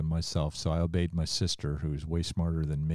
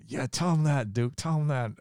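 a hint of mama cheering her son on from heaven, higher; imitating mama; 8 semitones higher than usual. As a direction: lightly voice the mother urging him on, higher. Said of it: "she's probably saying".

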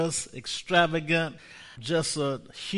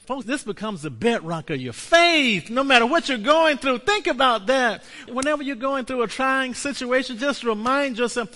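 high and loud exhortation; full-force preaching; 10 semitones higher, 7 dB louder than usual. Real, not acted. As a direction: urge them hard, lifting the voice. Preaching climax.

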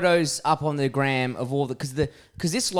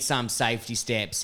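usual speaking voice

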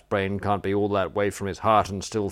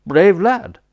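higher and louder, spelled out for the deaf; repeated hearty praise; 10 semitones higher, 9 dB louder than usual. Real, not acted. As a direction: say it again, louder. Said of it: "I said".

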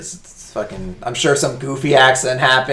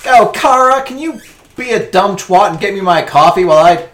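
high, loud mocking boss voice; loud, high mock of a condescending boss; 7 semitones higher, 8 dB louder than usual. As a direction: sneer it out as the bossy editor. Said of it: "She's still like".

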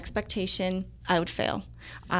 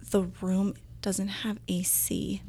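whispered dismay; 5 dB quieter than usual. Retold or imitated. Newcomer discovers the room has no AC, whispering in dismay. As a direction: whisper it like a horrified private thought. Private horrified thought, retold.

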